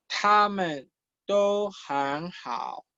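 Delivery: drawn-out, careful teacher's reading; slow model reading. Shown as a demonstration. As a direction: space out each word for learners. Teacher models textbook dialogue line.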